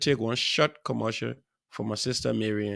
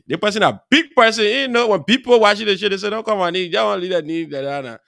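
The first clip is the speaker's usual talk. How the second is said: louder, higher, worked-up complaint; animated protest; 7 semitones higher, 8 dB louder than usual. Real, not acted. Host teasing a viewer who texts not to be named, animated protest. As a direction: go off on them, loud and high. Mock outburst over texter refusing mention.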